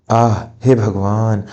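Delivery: slow pained groan calling on God; drawn-out ouch and a plea to God; pained cry. Acted. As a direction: cry out slowly as she falls. Old mother pushed to the floor.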